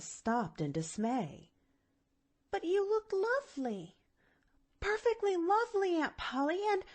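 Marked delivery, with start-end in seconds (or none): 2.5-6.8 put-on dismayed girl. A narrator's reading.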